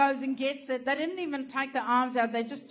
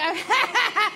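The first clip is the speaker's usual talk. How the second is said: high, loud imitated laughing; hammed-up mocking laugh; mock laughter; 8 semitones higher, 6 dB louder than usual. Staff laughing at her stuck in the dress, mock laughter.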